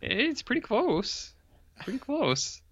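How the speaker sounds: high, slow goofy echo; high silly voice. Acted. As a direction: parrot it back in a squeaky voice. Echoes partner in silly voice.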